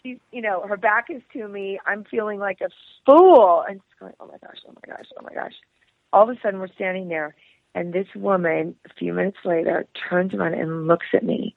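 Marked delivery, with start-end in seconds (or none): 4.2-5.5 hushed, very soft repeated murmur; mortified muttering. Retold or imitated. Embarrassed customer waiting behind the angry woman, muttering. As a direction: mutter it under your breath.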